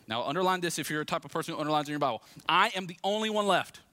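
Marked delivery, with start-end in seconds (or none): 2.5-3.8 high, loud declaration of being alone; emphatic complaint. Acted. Elijah protests to God, emphatic and loud.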